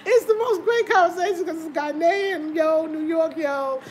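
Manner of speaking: talking high and loud through laughter; can barely get the words out for laughing; real giggling speech. Real, not acted. Laughing while telling.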